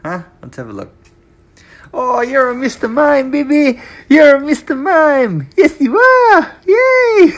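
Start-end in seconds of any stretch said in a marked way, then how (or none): 1.9-7.3 loud, high, thrilled squeals. Real, not acted. Mother thrilled at baby's Mister Mime costume, loud high squealing.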